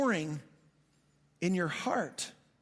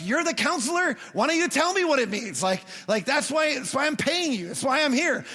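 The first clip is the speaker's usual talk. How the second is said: frustrated outburst; 5 semitones higher, 3 dB louder than usual. Retold or imitated. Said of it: "And I'm like".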